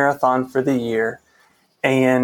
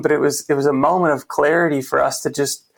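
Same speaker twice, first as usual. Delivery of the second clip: ordinary talk, nothing marked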